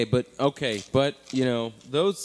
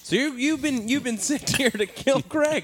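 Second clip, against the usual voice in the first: higher, incredulous realisation; mock shock; 8 semitones higher than usual. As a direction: act stunned at the news.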